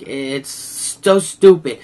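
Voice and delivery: slow, loud scornful drawl; a slow, loud put-down. Real, not acted. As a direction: stretch it out in disbelief at the silliness. Mocking the film's fake printout.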